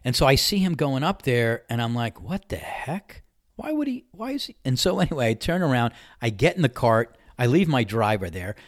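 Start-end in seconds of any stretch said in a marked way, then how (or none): 2.2-4.5 high, disbelieving mutter to himself; puzzled, half under his breath; baffled inner voice. Retold or imitated. Watching the man head for his balls, a high baffled mutter. Incredulous inner thought at golf.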